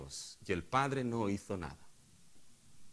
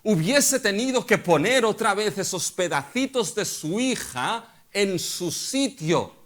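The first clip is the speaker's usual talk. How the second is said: loud, high, slow pulpit thunder; full-force sermon climax; preaching at full force; 8 semitones higher, 8 dB louder than usual. Acted. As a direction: drive each demand home, slowly. Preacher thunders what David should've done.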